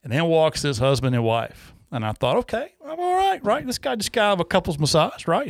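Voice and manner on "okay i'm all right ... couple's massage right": high-pitched acted inner voice; self-reassuring. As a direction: talk yourself into feeling okay, pitched way up. Retold thought, reassuring himself.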